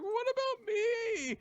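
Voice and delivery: very high, slow plaintive squeal; Sayra. Retold or imitated. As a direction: stretch it out in a squeaky character voice. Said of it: "so Sayra's like".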